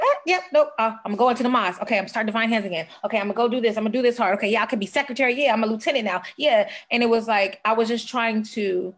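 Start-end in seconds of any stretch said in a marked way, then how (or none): 0.0-6.7 mock busy self.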